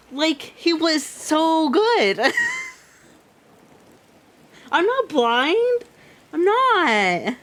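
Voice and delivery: high, slow, upset complaint; whiny lament. Real, not acted. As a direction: mourn the good guy. Upset at twist.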